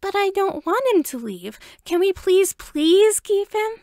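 put-on high, pleading little boy voice; excited kid begging his parents; chirpy begging child. A narrator's reading. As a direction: beg like a five-year-old.

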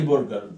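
"برجر" said as "borgar"; Arabized vowel. Shown as a demonstration. Teacher gives the everyday Arabic form, "برجر" as borgar. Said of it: "ما اسمهاش برجر".

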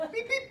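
high, piercing double beep; shrill mouth-made beep-beep; imitated walkie-talkie beep. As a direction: mimic a radio call tone in two squeaky chirps. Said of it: "this thing fucking beeps every time".

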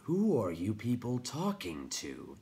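slow, gravelly stone creature; crackling rock voice. A narrator's reading. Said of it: "the rock chewer crackled".

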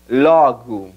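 LOGO as "LAW-goo"; open o, reduced o. Shown as a demonstration. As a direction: open the first o in LOGO, shrink the last to u. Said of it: "Abre la primera O y reduce la segunda".

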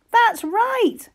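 high excited That's right; excited praise. Acted. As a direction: cheer the child on. Praising child's answer.

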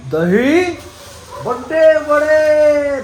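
high, loud, drawn-out amazement; surprised. Real, not acted. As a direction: stretch it out in delight. Reacting to dahi bade.

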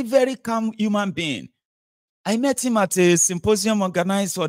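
high, starry-eyed voice of a dazzled fan; gushing admirer. Retold or imitated. A fooled citizen gushing over a rogue politician, high and starry-eyed. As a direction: gush like someone charmed by a smooth politician. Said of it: "And you say, Oh".

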